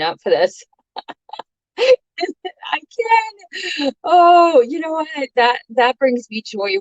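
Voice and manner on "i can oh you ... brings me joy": delighted. Real, not acted. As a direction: gasp with surprised delight. Delighted by a viewer comment.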